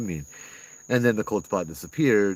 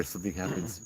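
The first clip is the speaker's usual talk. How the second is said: ordinary talk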